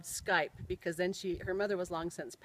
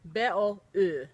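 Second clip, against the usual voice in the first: "B O U" as Finnish letter sounds, louder; Finnish accent; 6 dB louder, slower than usual. Shown as a demonstration. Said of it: "boy with the Finnish accent".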